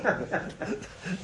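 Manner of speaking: dissolving into laughter mid-line; laughing through the quote. Retold or imitated. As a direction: crack up while finishing the yell. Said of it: "that guy starts screaming".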